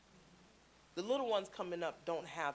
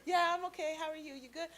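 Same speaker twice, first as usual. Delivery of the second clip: put-on high-pitched kid on autopilot; imitated distracted nephew; 10 semitones higher, faster than usual. Nephew answering auntie while on his tablet, high kid voice. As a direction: mimic a child's absent replies, pitched up. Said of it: "They sitting there like this the whole time".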